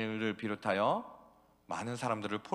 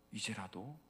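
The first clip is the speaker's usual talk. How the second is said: 이제라도 much softer, rising; 이제라도 almost whispered; soft plea; 4 semitones lower, 11 dB quieter than usual. Acted. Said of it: "repeated plea".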